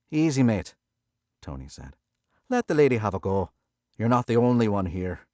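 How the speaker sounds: a touch of Irish calm authority; Irish-accented casino boss telling Billy to back off; Irish pit boss. A narrator's reading. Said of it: "a thick Irish accent".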